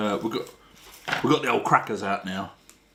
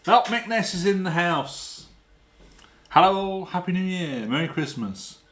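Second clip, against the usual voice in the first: hyped host greeting; 7 semitones higher than usual. Acted. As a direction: announce the arrival like a showman.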